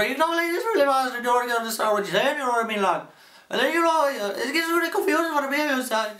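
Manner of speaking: very high, fast Irish chatter; Irish accent with style. Shown as a demonstration. Teacher adding style to his Irish voice, fast high Irish rant. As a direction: rattle it off loud in a squeaky Irish accent. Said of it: "you can add style to it".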